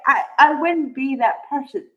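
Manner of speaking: usual speaking voice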